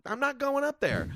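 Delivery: high-pitched, whiny protest; retold refusal. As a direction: dig your heels in, voice way up. Comic refusing to go on stage.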